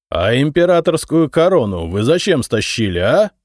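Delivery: нависая с угрозой, громче; грозно допросить; угрожающе. A narrator's reading. (угрожающий допрос)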